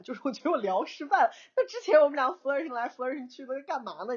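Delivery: high-pitched, half-laughing complaint; laughing exasperation. Retold or imitated. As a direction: protest it with a helpless laugh. Exasperated laugh at failed flirting. (又好笑又无奈地抱怨)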